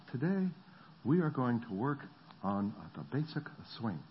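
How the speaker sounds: a hint of the Japanese coach; Japanese accent. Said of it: "I'm not making fun, just a flavor".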